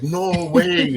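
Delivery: high, squealing "no way"; excited disbelief. Retold or imitated. Drunk overjoyed at the hockey coach link, high squeal. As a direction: gush it like a fan. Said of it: "And he's like".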